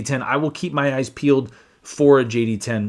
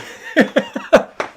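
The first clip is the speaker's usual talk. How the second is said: high breathy laugh bursting out; real burst of laughter; 4 dB louder than usual. Real, not acted. Dad laughing at his kid during a live stream. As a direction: laugh helplessly at the interruption.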